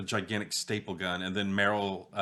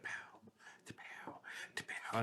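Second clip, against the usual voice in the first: gunshot sounds; 14 dB quieter, slower than usual. Retold or imitated. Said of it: "'Cause he was like".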